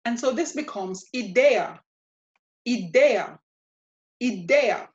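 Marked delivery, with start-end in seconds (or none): 1.1-1.8 "idea" as ee-DEH-ah, DEH stressed; Spanish "idea", punching the middle syllable DEH; stress second-to-last syllable. Shown as a demonstration. Teacher models the word for learners, "idea" stressed ee-DEH-ah. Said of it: "put the stress on the second to last". 2.7-4.9 "idea" as ee-DEH-ah, DEH punched; stress second-to-last syllable. Shown as a demonstration. Teacher repeats for learners to copy, "idea" stressed ee-DEH-ah. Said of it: "put the stress on the second to last".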